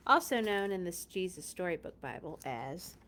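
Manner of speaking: ordinary delivery, nothing marked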